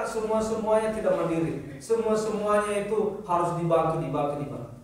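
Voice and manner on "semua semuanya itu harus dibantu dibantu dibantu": ordinary talk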